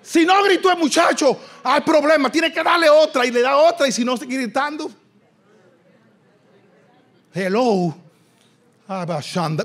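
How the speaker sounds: usual speaking voice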